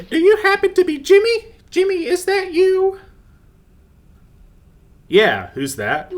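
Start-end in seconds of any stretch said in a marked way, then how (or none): 0.1-3.0 put-on very high, sweet old granny; grandma voice. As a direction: squeak it out like a doting grandma. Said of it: "grandma voice".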